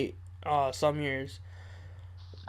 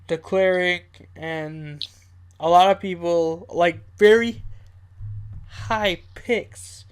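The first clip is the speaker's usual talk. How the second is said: usual speaking voice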